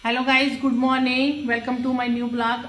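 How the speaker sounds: drawn-out cheerful hello to viewers; vlog greeting. Real, not acted. Vlogger greeting her viewers, drawn-out and upbeat.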